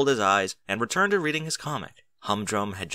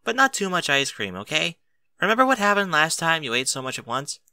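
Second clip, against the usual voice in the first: mild concern; 7 semitones higher than usual. Acted. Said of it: "mild concern into her voice".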